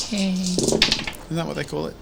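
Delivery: ordinary talk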